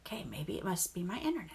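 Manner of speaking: fast whisper to herself; whispered thought. Retold or imitated. Stuck updating at home, whispering her frustrated thought. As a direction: mutter the worry under her breath, quickly.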